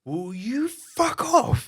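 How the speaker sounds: high, loud, very slow stretched yell; retold explosion of rage; furious outburst. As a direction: lose it at the stranger. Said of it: "I lost my shit".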